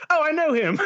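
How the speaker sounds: high, delighted "oh, I know him"; mock recognition. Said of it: "hoping that they would be like".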